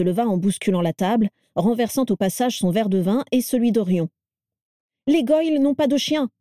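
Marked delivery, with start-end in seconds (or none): 5.1-6.3 high-pitched alarm; panicked exclamation. A narrator's reading. Crabbe hearing dogs outside, panicked. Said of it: "s'exclama-t-il affolé".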